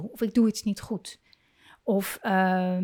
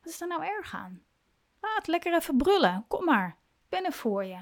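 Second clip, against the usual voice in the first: higher, soft, unbothered let-it-out voice; easygoing comfort; 6 semitones higher, 3 dB quieter than usual. Shown as a demonstration. Parent letting the child roar it out, relaxed and soft. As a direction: shrug it off and comfort gently. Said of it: "zou je jezelf kunnen afvragen".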